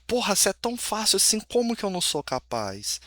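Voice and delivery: lightly acted frustrated self-questioning; voicing self-doubt. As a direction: act out a nagging thought of not being capable. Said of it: "esse sentimento de insuficiência, de incapacidade".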